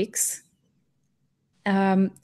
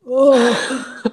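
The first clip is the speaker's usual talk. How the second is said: mock groan; 4 semitones higher, 4 dB louder than usual. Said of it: "That was my reaction".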